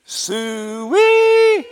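very high, very loud drawn-out hog call; full-throated high farm holler. Shown as a demonstration. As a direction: bellow it so the whole pasture hears. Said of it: "Say that with me".

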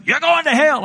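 high-pitched call from a car window; mock taunting neighbor. Neighbor kids hanging out the car window, high mock taunt. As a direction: pitch it way up, imitating a taunting kid. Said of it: "they would hang out the window".

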